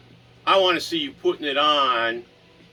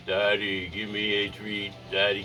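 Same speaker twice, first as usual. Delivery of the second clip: dog's voice; 7 semitones lower, 6 dB quieter than usual. Pretend. Voicing dog asking for treat.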